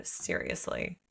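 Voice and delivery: "seriously" dropped low and soft with a rising end; exasperated seriously. Real, not acted. Host reacting to a stubborn man, low exasperated "seriously". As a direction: sigh out "seriously" in disbelief. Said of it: "My God".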